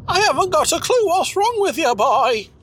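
put-on old man voice. High old character voice.

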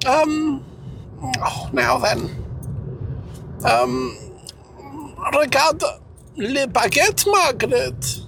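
put-on high hammy French accent; mock French voice. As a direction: ham up a silly French lover. Mock French couple role-play.

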